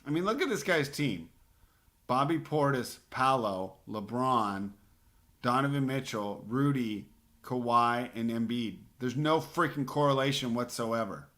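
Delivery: exasperated rant. Real, not acted. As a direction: vent at the rival's nonsense roster. Ranting about rival's roster.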